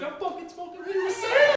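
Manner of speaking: high, laughing disbelief; giddy excitement. Retold or imitated. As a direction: squeal with drunken glee. Thrilled younger self.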